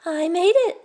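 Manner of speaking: put-on high, light boy's voice; a simple proud answer from a boy; young boy speaking plainly.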